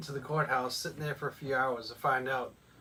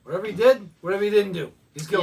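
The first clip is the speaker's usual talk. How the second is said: loud, higher, emphatic declaration; mock juror verdict; 4 semitones higher, 9 dB louder, faster than usual. Friend playing an impatient juror, loudly declaring guilt. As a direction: bang the table like a biased juror.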